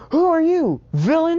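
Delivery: high-pitched, startled demand; acted alarm, voice shooting up; alarmed challenge. Teachers challenge a stranger, acted.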